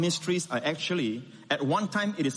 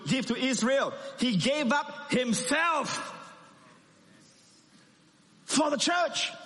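building sermon climax; 7 semitones higher than usual. Acted.

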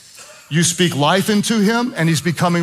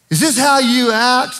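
Jezebel taunt; 8 semitones higher, 3 dB louder than usual. Retold or imitated. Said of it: "I've just heard it said this way".

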